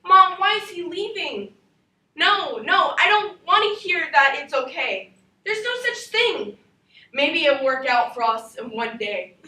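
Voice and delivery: loud, furious daughter shouting at mom; teen girl screaming stop lying to me; angry yelling. Acted. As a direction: shout it at your mother, refusing comfort. Girl yells at mother.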